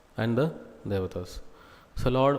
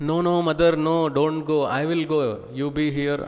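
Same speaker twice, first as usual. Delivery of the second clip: put-on higher, louder childlike plea; a little calf pleading with its mother, raised and urgent; calf begging; 4 semitones higher, 5 dB louder, much faster than usual. Calf begs mother not to go.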